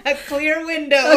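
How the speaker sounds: loud laughing joke. Real, not acted. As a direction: crack up while saying it.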